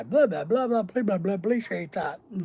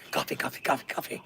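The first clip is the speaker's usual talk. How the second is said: soft, breathy whisper, much softer; whispered movie quote; 6 semitones lower, 8 dB quieter, faster than usual. Retold or imitated. Quoting Dante's Peak to chat in a hushed whisper. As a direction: hush it like a secret line from the film. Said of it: "that was from the movie Dante's Peak".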